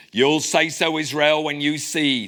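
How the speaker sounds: hammed-up Devon pirate burr; cod Devon accent. Lecturer reading Silver's words to the crew, cod Devon accent. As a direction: roll out Silver's lines in mock West Country. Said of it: "read this in a sort of cod Devon accent".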